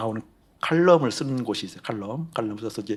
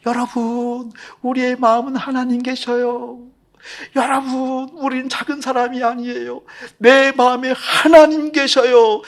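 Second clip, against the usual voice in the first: high, very loud, slow fervent preaching; 12 dB louder, slower than usual. Acted. Pastor proclaims God's presence to congregation, fervently preaching.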